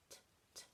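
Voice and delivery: very soft unvoiced T, t; whispered t sounds. Shown as a demonstration. Teacher isolating the t sound for children, whispered. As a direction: barely breathe out T, t. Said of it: "Can you hear the sound".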